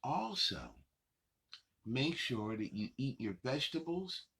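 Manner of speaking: ordinary delivery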